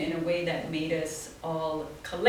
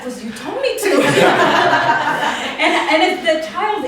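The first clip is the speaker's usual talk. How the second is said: laughing; 9 semitones higher, 13 dB louder than usual. Real, not acted. Child's answer, laughing.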